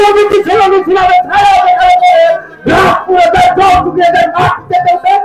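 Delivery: high, fast, booming royal proclamation; booming warrior king. Acted. Nawab declaims at full force.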